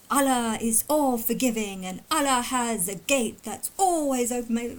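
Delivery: lightly mocking religious talk; mock slow preaching. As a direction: drawl it out like a sermon. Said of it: "if you just wanna talk like this and say things like".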